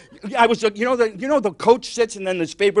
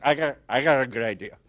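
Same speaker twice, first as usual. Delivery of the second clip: low, dopey stoner voice; stoned drunk; 7 semitones lower, 4 dB quieter than usual. Acted. Stoned Olympics inventor pitching an idea, dopey. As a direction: sound wasted, having a low, sluggish brainwave. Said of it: "They smoke marijuana, drink vodka all day".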